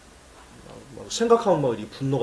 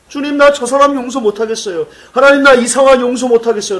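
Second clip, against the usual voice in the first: louder, higher pleading prayer; crying out to God; 8 semitones higher, 9 dB louder than usual. Pretend. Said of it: "라고 하면서 우셔야 됩니다". (하나님께 울부짖는 기도)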